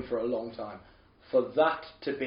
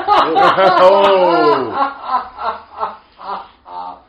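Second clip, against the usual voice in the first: loud laugh; 6 semitones higher, 12 dB louder than usual. Real, not acted. Hearing the King Arthur title, a loud real laugh.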